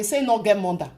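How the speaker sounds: usual speaking voice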